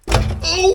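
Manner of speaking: sharp cry of pain, high and loud; pained yelp. Real, not acted. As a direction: yelp as it hurts. Sudden injury while working.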